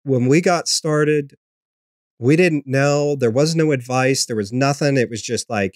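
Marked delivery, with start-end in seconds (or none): none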